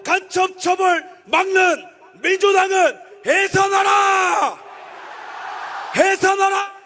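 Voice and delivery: fiery political rally speech; roaring rallying speech. Acted. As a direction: shout it to the crowd. Rally chant.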